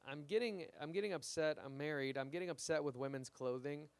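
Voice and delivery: usual speaking voice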